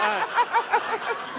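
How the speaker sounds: high yelp of mock fright through laughter; laughing shocked gasp. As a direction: squeal like you just got caught out. Shocked reaction, retold laughing.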